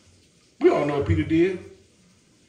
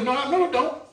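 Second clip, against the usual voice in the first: Peter denying; 6 semitones higher, faster than usual. Acted. Peter denying the maid, voiced.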